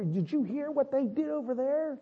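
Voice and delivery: high, soft gossiping voice; high-pitched and hushed, voicing worried townsfolk; hushed rumor. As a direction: pass it on like an alarming rumor. Enemy people passing on fearful rumors.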